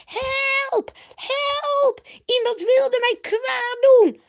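very high, loud acted shriek; screeching cry for help. A narrator's reading. Potifar's wife falsely cries for help, screeching. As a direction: scream for rescue at the top of your voice. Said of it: "Dan begint ze te krijsen en te roepen".

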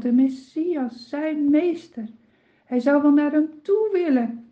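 ordinary delivery

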